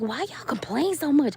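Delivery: high-pitched, hammed-up indignation; mock exasperation. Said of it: "I get so mad".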